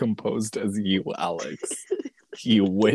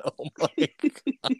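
laughing imitation; 11 semitones higher, 4 dB quieter, slower than usual. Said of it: "the way she said".

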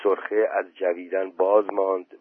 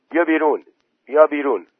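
higher, louder urging call; 7 semitones higher, 6 dB louder, faster than usual. A narrator's reading. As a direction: urge someone out of the water. Villager calls boy out of pool.

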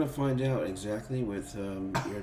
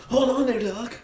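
high-pitched comic imitation; movie character quote; 10 semitones higher than usual. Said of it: "when he's like".

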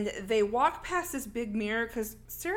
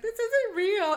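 high, louder, imitated distress; mock panic; 11 semitones higher, 5 dB louder, slower than usual. Retelling Sarah seeing her cut wrist, mock-panicked high cry. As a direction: play up a victim's panicked denial.

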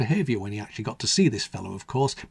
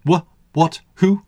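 startled, higher, louder stammer; spluttering; 6 semitones higher, 6 dB louder, slower than usual. A narrator's reading. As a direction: splutter awake in confusion. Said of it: "he spluttered".

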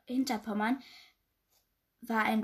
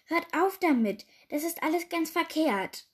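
high-pitched, exasperated pleading; acted despairing queen; desperate; 6 semitones higher, 3 dB louder than usual. A narrator's reading. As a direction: beg them to stop, pitch raised. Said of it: "zischte die Königin verzweifelt".

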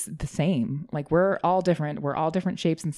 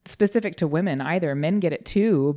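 ordinary delivery

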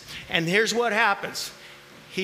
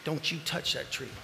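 much softer, low stern warning; quiet warning; 6 semitones lower, 8 dB quieter than usual. Shown as a demonstration. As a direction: hush it like a private command. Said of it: "Pausing for effect".

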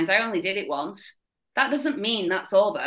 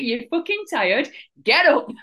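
higher, louder barked order, half-joking; tough coach; 6 semitones higher, 5 dB louder than usual. Retold or imitated. Coach yelling at a tired athlete, mock drill shout. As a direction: snap at them to get up. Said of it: "don't take any shit".

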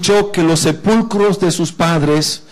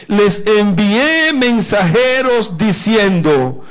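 slow, higher, declaimed verse; emphatic scripture reading; 4 semitones higher, slower than usual. Acted. Preacher reads Nehemías's answer to the congregation, slow emphatic proclamation. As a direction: proclaim it slowly, stretching each word.

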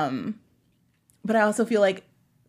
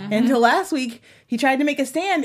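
ordinary talk, nothing marked

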